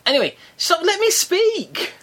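high, slow, insistent cry to cut in; shouting to be heard. Real, not acted. To a friend who keeps interrupting, a high insistent shout. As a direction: demand the floor over a friend.

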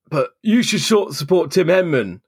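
high, lightly voiced insistent man; mock the patriotic punter; imitating pub bloke. Bloke in the pub demanding she back Henman, mock insistent. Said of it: "and he said".

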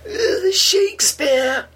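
very high, slow shrieked name; a hammed-up Iron Lady crying out; Margaret Thatcher. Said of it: "he did a Margaret Thatcher impression".

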